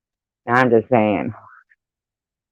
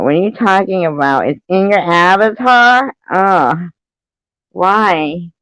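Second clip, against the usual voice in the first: shocked; 8 semitones higher, 5 dB louder than usual. Real, not acted. Shocked by chat message.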